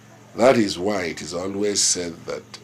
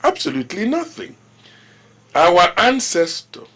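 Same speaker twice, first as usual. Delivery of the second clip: loud, high-pitched, forceful rebuff; indignant refusal; 11 dB louder than usual. Acted. Ruler refusing to look into the chairman's complaint, indignant. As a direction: shut the matter down in a raised, commanding voice.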